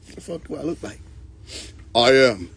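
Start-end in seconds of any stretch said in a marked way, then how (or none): 1.9-2.5 slow, pompous self-introduction; mock grand deity. Self-proclaimed god announcing who he is, slow and grandiose.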